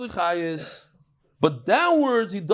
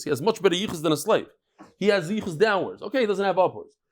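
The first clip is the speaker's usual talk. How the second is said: usual speaking voice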